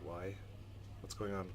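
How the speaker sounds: ordinary delivery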